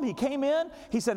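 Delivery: ordinary delivery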